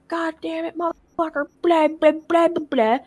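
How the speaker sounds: high, held-back mock yelling; high-pitched retold swearing, trailing into blahs; overheard cursing dad. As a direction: imitate muffled ranting from another room. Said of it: "we just hear him like".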